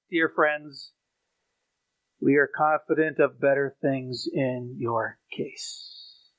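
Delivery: low, soft and slow; tender soft reading. Acted. Preacher reassures congregation of better things, softly. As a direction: ease into comfort gently.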